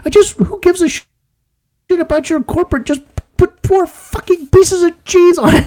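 high, strained mock rage; mock freak-out. Customer losing it at the drive-through attendant, high-pitched mock rage. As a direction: blow up in a high squeal of fury. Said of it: "He's like".

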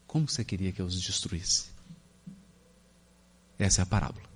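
low, soft, patient reply; tender God voice. Acted. God gently answering sulking Jonas, low and tender. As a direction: gently reason with the sulking prophet.

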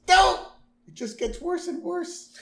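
mock Homer outburst. Homer yelping at his horoscope, high and loud. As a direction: squeal it like a panicked Homer.

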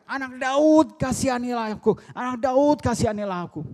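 high, loud cry for mercy; acted high-pitched yell of begging; shouted plea. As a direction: call out desperately to someone passing by. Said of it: "dia teriak".

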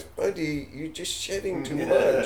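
high, squeaky pet voice; dog voice. Acted. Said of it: "It's my dog voice".

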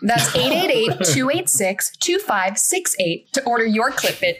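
upbeat closing ad read; ad announcer. Acted. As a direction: pitch it like a TV ad tag.

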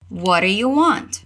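slow model with "do you" reduced. Shown as a demonstration. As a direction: say "What do you" slowly, reduced to "wɔɾ ju". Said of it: "Again, de novo". (devagar, com redução)

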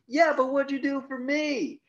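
high, loud mocking heckle; heckler from the crowd. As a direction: yell it up from the crowd. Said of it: "from the crowd goes".

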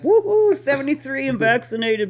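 put-on high, loud gloating old lady cheer; granny whooping over her vaccine; gloating grandma. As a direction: crow it like a triumphant grandma. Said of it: "Grandma's like".

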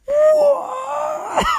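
shrill, very high cry of disbelief; hammed-up high-pitched gasped What; mock shock. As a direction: shriek it like a cartoon. [name] reacts to the news.

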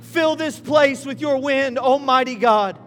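loud, high preaching prayer; preaching at full force. Real, not acted. Pastor calling on the Spirit, loud preaching prayer. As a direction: cry out to God over the congregation.

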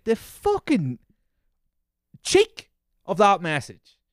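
high, loud, drawn-out outrage; indignant outburst. Real, not acted. Outraged at the message.

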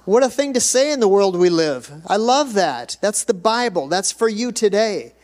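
high-pitched pulpit enthusiasm; fervent preaching. Real, not acted. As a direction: press it on the congregation with conviction.

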